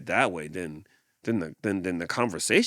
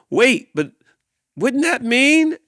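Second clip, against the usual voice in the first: alarmed leopard; 11 dB louder than usual. Acted. Leopard realises the tumble.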